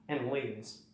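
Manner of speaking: normal talking voice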